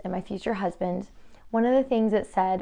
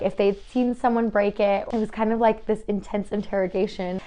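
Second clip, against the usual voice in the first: ordinary talk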